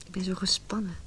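tense whisper; anxious whisper. Acted. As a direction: confess it under your breath. Woman confides her fears.

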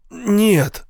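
high, sharp cry of no; pained exclamation. A narrator's reading. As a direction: blurt it out through pain. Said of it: "воскликнул он и скривился от боли".

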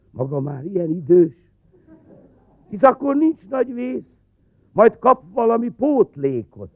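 official's voice. Acted. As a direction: play the cheerful clerk.

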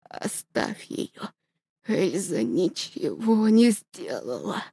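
father gasping last orders to daughter; dying whisper. Acted. (тихо, шёпотом, умирая)

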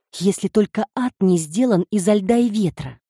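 ordinary delivery

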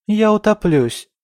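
high, loud wail through tears; a girl sobbing a desperate threat; sobbing outburst. A narrator's reading. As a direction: cry it out in despair. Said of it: "Снова разрыдалась".

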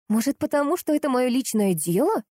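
sarcastic, sing-song naivety; exaggerated sarcasm. A narrator's reading. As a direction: ask it with feigned innocence. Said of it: "с преувеличенным сарказмом спросила".

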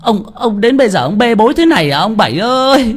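high, loud wail building to a yell; distraught scolding. A narrator's reading. Wife explodes at husband over child. (la lối bực tức, giọng cao dần)

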